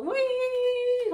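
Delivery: put-on high, loud playful wih as if lifting a child; excited squeal. Mother retelling lifting her son, playful high squeal. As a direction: squeal with delight like swinging a kid up.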